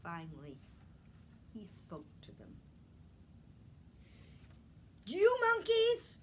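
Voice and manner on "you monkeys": much higher, louder scold at the monkeys; scolding peddler. Acted. Peddler scolding the monkeys in the tree, loud and high. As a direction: wag a finger with your voice. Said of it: "he said".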